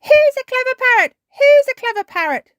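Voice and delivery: high, loud squawky bird voice; parrot mimicry. A narrator's reading. Polly forgets the list and parrots praise, high squawky voice. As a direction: repeat it like a pleased parrot, squawking. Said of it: "Polly Parrot opened her beak and said".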